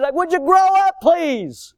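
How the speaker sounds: high, loud pleading scold; exasperated plea. Real, not acted. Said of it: "I feel like saying that".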